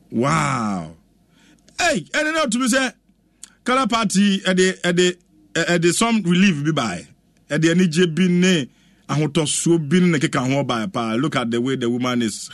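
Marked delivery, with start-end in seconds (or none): none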